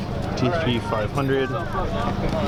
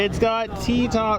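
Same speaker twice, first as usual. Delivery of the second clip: excited shout; 9 semitones higher, slower than usual. Real, not acted. Thrilled at spotting a car.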